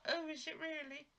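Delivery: mock high-pitched delight; high delighted woman. Retold soap, happy woman.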